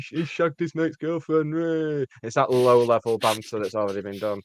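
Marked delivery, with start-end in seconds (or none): 0.1-2.1 slow, drawn-out mocking voice; jeering referee commenter. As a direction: drag it out like a crude online heckler. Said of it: "the referee will be, like, commenting, going".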